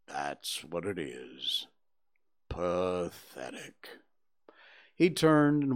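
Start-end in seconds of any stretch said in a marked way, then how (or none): 0.1-3.9 very low, slow and mournful Eeyore; gloomy donkey. A narrator's reading. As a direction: drag it out like a sad old donkey. Eeyore speaks, read aloud.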